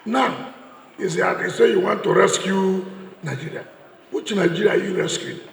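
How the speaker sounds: loud, ringing campaign-stage delivery; fiery rally oratory. Real, not acted. Speaker addressing a rally crowd about eight years, fiery loud oratory. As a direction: hammer each charge home to the crowd.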